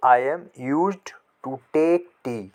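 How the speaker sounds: very slow and deliberate, each word separated; teacher showing children the English version, very slowly; slow model sentence. Shown as a demonstration. Read it out slowly for the class. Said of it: "तो".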